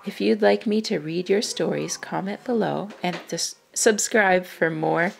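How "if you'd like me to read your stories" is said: ordinary delivery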